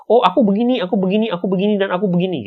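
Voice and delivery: high eager rush of excuses; over-explaining. Retold or imitated. Person answering a simple question at great length, eager. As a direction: pile on explanations breathlessly. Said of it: "berusaha menjelaskan sejelas-jelasnya".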